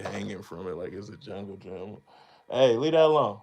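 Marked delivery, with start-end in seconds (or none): 2.5-3.4 loud and higher, leave that alone; telling off. Real, not acted. Warning his kid off the equipment, loud call. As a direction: call out sharply to stop them.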